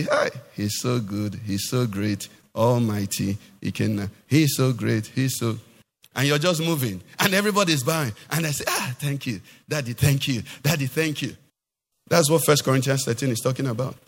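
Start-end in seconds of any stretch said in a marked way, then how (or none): 0.0-5.6 mock fawning praise. Mimicking fawning admirers of preacher. 8.7-11.4 lightly voiced follower murmuring thanks; a hint of a bowing devotee thanking Daddy; mock grateful follower.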